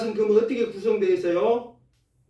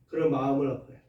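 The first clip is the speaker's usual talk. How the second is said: ordinary talk, nothing marked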